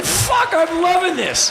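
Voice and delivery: high, loud exclamation of delight; thrilled yell. Retold or imitated. Fan delighted by the rock star, high thrilled yell. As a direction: shout it out, giddy with fandom.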